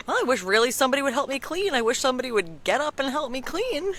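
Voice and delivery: high, loud, rushed hinting to nobody in particular; passive-aggressive. Retold or imitated. Mom hinting to the kids that she wants cleaning help, passive-aggressive. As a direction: pointedly wish aloud for help, pitched high. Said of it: "these passive-aggressive comments".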